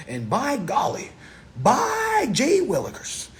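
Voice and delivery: put-on high, slow, old-timey exclamation; high, slow, corny gee-whiz voice; hammed-up folksy exclaimer. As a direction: ham it up like a corny old-timer. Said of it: "I am definitely performing".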